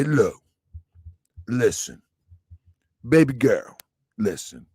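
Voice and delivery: put-on low, soft daddy voice talking his girl down; tender father, low and quiet; hushed dad soothing his daughter; soft fatherly advice. Voicing a soft fatherly talk.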